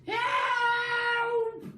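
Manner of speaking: very high, loud shrieking cry for help; full-throated shriek of fright; panicked scream. Acted. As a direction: shriek like a terrified old hag. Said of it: "screamed Missus Twit".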